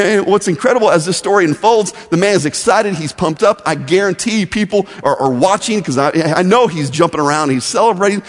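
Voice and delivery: ordinary talk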